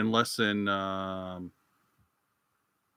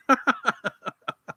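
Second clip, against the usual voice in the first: high-pitched cackling laugh; 14 semitones higher, 5 dB louder than usual. Real, not acted. Cracks up at the French grape joke, high laugh. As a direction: burst out in high, louder laughter.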